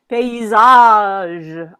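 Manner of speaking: paysage with its last syllable, -age, long and loud; stress on last syllable. Shown as a demonstration. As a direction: lean hard and long on the end of paysage. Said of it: "from that tonic stress".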